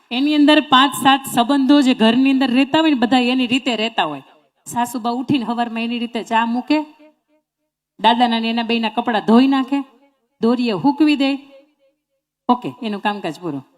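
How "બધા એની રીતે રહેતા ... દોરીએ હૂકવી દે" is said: ordinary talk, nothing marked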